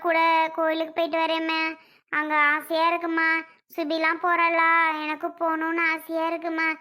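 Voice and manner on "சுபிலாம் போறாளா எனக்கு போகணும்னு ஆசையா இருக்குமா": ordinary talk, nothing marked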